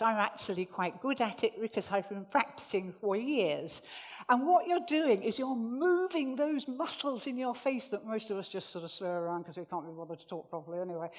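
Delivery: usual speaking voice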